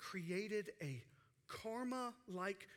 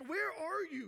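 higher, mildly shouted challenge; retold shout; 6 semitones higher, 4 dB louder than usual. Moses shouting back at God, imitated mild shout. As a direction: call back at God, pitched up. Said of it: "he's shouting back".

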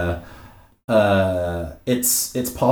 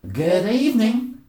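wobbly put-on cowboy accent; bad cowboy accent; 8 semitones higher than usual. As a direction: greet them in a badly faked cowboy accent. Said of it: "I'm so bad at this accent".

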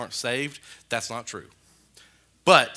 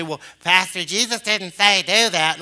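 loud, high mock protest; imitated objection, pitched up; objecting congregant; 5 semitones higher, 9 dB louder than usual. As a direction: put on a complaining churchgoer's voice, loud and raised. Voicing an objector to the preacher.

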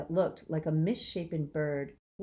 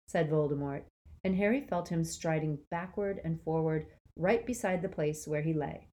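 usual speaking voice

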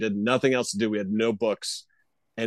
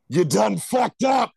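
high, slow mock voice; sing-song told-you-so from the body; cartoon body scolding its owner; body's warning voice; 9 semitones higher, 4 dB louder, slower than usual. Body voiced delivering message.

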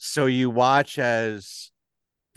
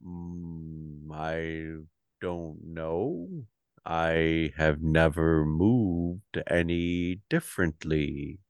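lower, slow Goliath voice; slow, deep giant voice; 6 semitones lower, slower than usual. Acted. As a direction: speak slow and low like a huge half giant.

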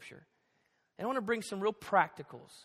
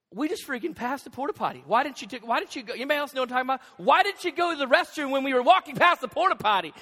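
higher, loud scolding as a fed-up father; retold parental rant, building louder; exasperated dad; 5 semitones higher, 8 dB louder, faster than usual. Dad scolding his daughter over the missed porta potty, exasperated.

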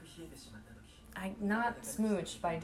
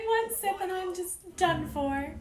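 higher, slow put-on tipsy lady; slow and lightly sloshed, pitched up; 10 semitones higher than usual. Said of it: "She's like".

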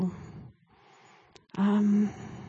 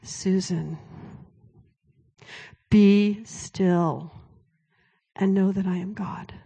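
God's calm voice; slower than usual. Retold or imitated. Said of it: "he just says to me".

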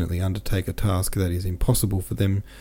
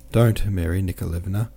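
ordinary delivery, nothing marked

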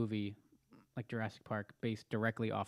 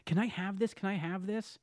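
put-on high, eager child pleading; excited kid begging; 8 semitones higher than usual. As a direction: beg like a thrilled ten-year-old.

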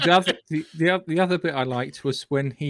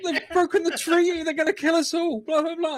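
high-pitched, hammed-up alarm; mock panic; 12 semitones higher than usual. Imitating the crew spotting Kaelon ships, mock panic. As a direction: rattle off a comic red alert. Acting the crew's panicked reaction.

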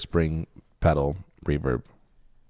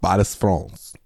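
Paris, France with a lightly put-on French accent; mock French accent; slower than usual. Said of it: "just me saying things".

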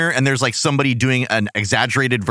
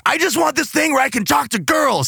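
put-on whiny kid; 13 semitones higher than usual.

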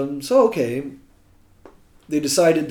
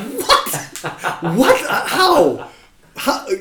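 high, loud panicked what; sudden stage fright, voice shooting up; panicked disbelief; 12 semitones higher, 6 dB louder, much slower than usual. Retold or imitated. Panic at being called on.

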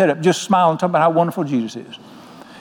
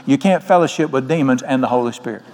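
ordinary delivery

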